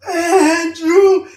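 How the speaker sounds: high, loud howl in unison; howling dogs. Retold or imitated. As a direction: imitate a whole pack howling a name. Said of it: "they all howled in the same".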